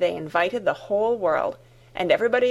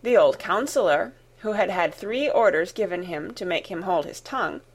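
ordinary delivery, nothing marked

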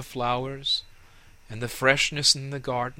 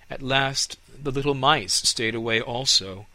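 usual speaking voice

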